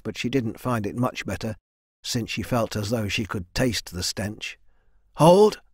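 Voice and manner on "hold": sharp, high shouted command. A narrator's reading. Leader halting his men at work, shouted. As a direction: call the halt loudly. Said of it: "Rin shouted".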